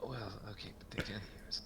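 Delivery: hushed, urgent aside to companions; stage whisper. Acted. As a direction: whisper it so they won't overhear. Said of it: "this is all stage whispered".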